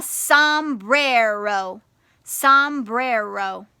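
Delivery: sombrero in three slow, stretched beats; syllable by syllable. Shown as a demonstration. Teacher sounds out a picture word, sombrero syllable by syllable. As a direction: clap out som-bre-ro, one syllable at a time. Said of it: "practice clapping your syllables".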